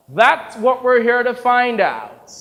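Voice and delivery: loud, high, drawn-out declaration; loud hearty retort. Acted. Director answering doubts about the new actor, loud hearty retort. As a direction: belt it out slowly and high. Larry answers Howard's jibe.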